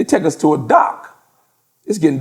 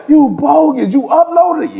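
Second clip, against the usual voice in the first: much higher, louder mock accusation; buddies accusing; 10 semitones higher, 4 dB louder than usual. Buddies teasing the teller for uploading, high mock accusation. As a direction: squeal it like teasing friends. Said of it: "They like".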